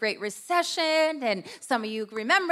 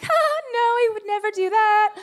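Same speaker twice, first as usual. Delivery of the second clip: much higher put-on nice voice; fake sweet voice; 9 semitones higher, 5 dB louder than usual. Wife covering for her loud husband, in a much higher, fake sweet voice. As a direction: sell the fake politeness. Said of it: "You're being so fake right now".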